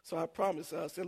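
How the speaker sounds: normal talking voice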